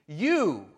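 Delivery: You stressed, set apart by a pause; emphatic you. Acted. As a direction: lean into the You. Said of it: "the Greek is emphatic there".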